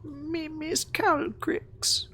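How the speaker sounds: very high, soft, slow little creature; forlorn kobold. Acted. Kobold refusing to leave without his dragon, forlorn squeak. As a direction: plead in a tiny, soft kobold voice.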